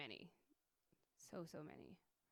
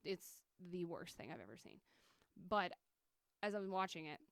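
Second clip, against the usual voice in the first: ordinary delivery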